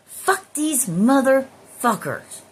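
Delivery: loud, high, drawn-out curse; vehement curse. Acted. Back in the recesses of your mind, vehement curse. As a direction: stretch each word and hit it hard. Same thought repeated with full force.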